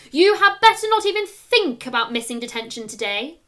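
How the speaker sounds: loud, stern threat; angry teacher threatening detention; stern teacher warning. A narrator's reading. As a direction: warn him hard, no escape. Angry teacher warns Hector.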